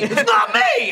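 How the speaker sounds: high shouted protest; screamed impersonation. Retold or imitated. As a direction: scream it until the voice could crack. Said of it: "then his voice will crack".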